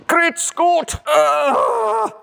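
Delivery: very high, loud exclamation; straining shout. Real, not acted. Mechanic wrestling a stuck bumper, high straining shout. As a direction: cry out loudly at the top of the voice.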